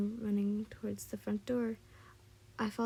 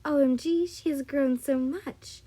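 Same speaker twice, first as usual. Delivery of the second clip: loud, higher gushing amazement; excited, raised outburst of surprise; gasped out; 5 semitones higher, 7 dB louder than usual. A narrator's reading. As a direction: exclaim it in delighted shock. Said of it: "she gasped out".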